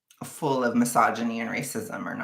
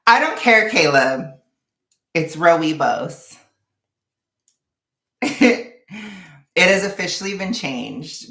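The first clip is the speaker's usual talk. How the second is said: mock-defiant; 6 semitones higher, 8 dB louder than usual. Vlogger refusing Caleb's correction of her pronunciation, mock-defiant. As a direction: overrule the correction loudly, stretching the last part.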